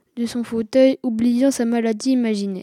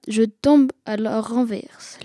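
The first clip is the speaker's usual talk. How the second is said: ordinary delivery, nothing marked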